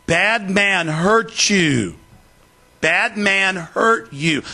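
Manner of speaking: mock baby-talk explaining; condescending slow talk. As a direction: spell it out slowly as if to a simpleton. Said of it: "that's how they talk to you".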